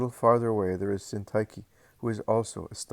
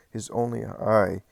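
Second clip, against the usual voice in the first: ordinary delivery, nothing marked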